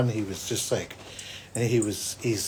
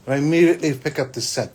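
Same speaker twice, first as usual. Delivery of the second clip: ordinary talk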